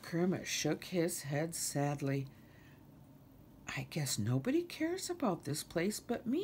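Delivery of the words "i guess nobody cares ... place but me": hushed, dejected sigh. A narrator's reading. Kermit feels alone in caring, sighing sadly. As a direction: sigh it out under your breath.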